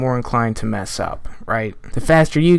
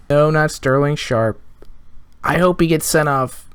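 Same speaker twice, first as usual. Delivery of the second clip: ordinary talk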